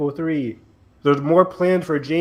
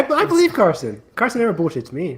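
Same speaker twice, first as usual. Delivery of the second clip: higher, oddly sing-song inflection; weird inflection; 7 semitones higher, 4 dB louder than usual. Real, not acted. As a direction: say it in a strange lilting tone, half laughing. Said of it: "That was a weird inflection".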